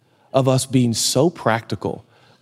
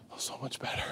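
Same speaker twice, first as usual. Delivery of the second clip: barely audible, whispered sigh of self-satisfaction; mock relieved whisper; 18 dB quieter than usual. Preacher mimicking someone proud of abstaining, mock relieved whisper. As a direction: whisper it to yourself, savouring it. Smug abstainer repeats it under breath.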